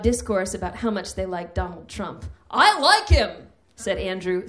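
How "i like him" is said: higher, louder, proud stage shout. Retold or imitated. Old Marine declaring support loudly, stage shout. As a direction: announce it for the whole bus. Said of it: "stage shouting again".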